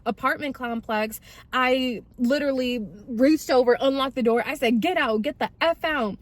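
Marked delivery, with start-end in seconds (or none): 4.8-6.2 retold higher-pitched demand to leave; angry order. Girlfriend ordering drunk boyfriend out of car, angry retold demand. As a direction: order them out, voice pushed up.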